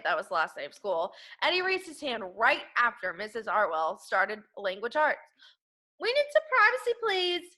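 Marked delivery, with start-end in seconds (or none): 6.0-7.6 put-on much higher, a boy asking politely; a boy asking his teacher for privacy, high and eager; high child voice. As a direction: pipe up as an eager kid. Eddie asks teacher for privacy.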